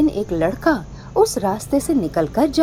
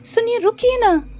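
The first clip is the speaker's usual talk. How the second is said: high, coaxing call; sweet calling girl; 12 semitones higher than usual. Acted. Witch calling Mohan to stop on the road, high and coaxing. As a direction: call him back sweetly.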